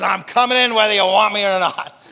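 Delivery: loud, pushy voice barging in; mock demand, almost shouting; hammed-up threat at the door; bossy intruder. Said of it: "He says".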